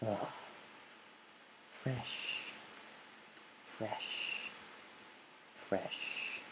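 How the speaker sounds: barely audible, whispered on the breath; very hushed, long exhaled word; calming whisper. Real, not acted. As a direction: whisper it to yourself like a mantra. Breathing out to calm himself.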